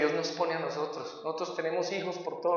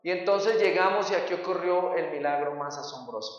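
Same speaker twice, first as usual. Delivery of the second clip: ordinary talk, nothing marked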